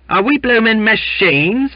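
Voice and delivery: very high, loud, piercing question; shrill heckle held high. A narrator's reading. Sailor goading the crew, piercing indignant cry. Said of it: "inquired Duncan in a piercing tone".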